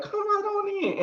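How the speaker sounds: very high-pitched mock welcome; effusive welcome. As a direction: imitate a host hurrying a guest inside. Retold host eagerly invites performer inside.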